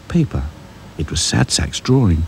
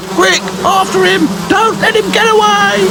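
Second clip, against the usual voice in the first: very high, urgent wasp shout; chasing wasps; 5 dB louder than usual. A narrator's reading. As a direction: cry out like hunting wasps.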